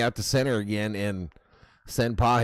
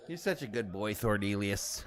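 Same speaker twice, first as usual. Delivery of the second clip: soft praise to the dog; 4 semitones lower, 9 dB quieter than usual. Real, not acted.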